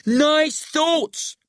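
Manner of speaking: high, loud-voiced stern order; stern interruption. A narrator's reading. Friend interrupts Billy's protest, stern. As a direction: cut in sternly, pitched right up. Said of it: "I interrupt sternly".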